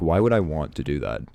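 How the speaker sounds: ordinary delivery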